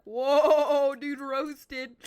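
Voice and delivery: loud whoa, dude, roasted in a put-on voice; mock roasted call. Speaker reacting to the teacher's insult, loud mock hype. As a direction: hype up the burn.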